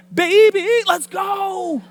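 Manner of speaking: very high, loud hype call; mock rapper. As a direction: belt it like a hammed-up rap intro. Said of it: "she's rapping at him".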